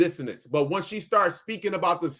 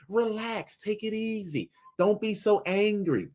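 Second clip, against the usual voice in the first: mock soothing; 4 semitones higher than usual. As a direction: mimic a patronizing voice telling him to calm down. Mocking the calm-down preachers.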